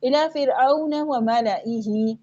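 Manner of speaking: slow recited "मलाइही" as mala-ihi; silent-alif recitation. Shown as a demonstration. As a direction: recite "मलाइही" measured, without lengthening the alif. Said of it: "ऐसे ही पढ़ना है".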